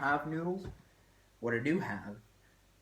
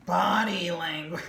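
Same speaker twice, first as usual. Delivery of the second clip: teacher's line; 6 semitones higher, 8 dB louder, slower than usual. Retold or imitated. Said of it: "really liked the line".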